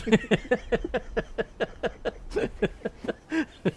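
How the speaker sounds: long, high run of laughter; real laughter. Real, not acted. Storyteller cracking up at his strippers anecdote, real laughter. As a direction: laugh on and on at your own story.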